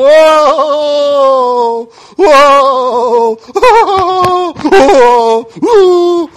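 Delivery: loud, high hammed-up wailing cries; mock wailing. Hired mourners wailing loudly at the funeral house, hammed up.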